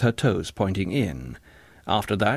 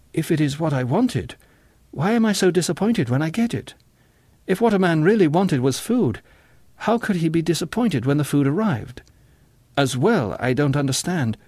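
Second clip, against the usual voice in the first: earnest, puzzled John; 6 semitones higher than usual. A narrator's reading. John reasons with the singer.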